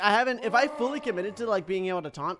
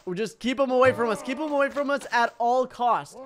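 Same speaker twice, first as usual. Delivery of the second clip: raised, insistent, higher voice; urgent; 5 semitones higher, 6 dB louder than usual. Real, not acted. Gamer keeping opponent at a distance, urgent and loud. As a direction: push it out loudly and urgently. Urgent tactic during fight.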